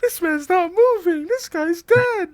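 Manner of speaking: high, loud, laughing disbelief; incredulous outburst. Acted. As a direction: crack up in shocked disbelief. Mocking the dummy scene.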